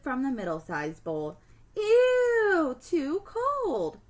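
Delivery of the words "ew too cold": disgusted. Acted. Girl tasting cold soup.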